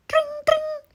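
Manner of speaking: high ringing bell sound; bell imitation. A narrator's reading. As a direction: chime it like a bike bell. Bell sound voiced in story.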